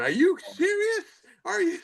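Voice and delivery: very high, wailing mock sob of the wife; crying wife. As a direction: squeal it out like the shocked, crying wife. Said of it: "you see her start crying and going".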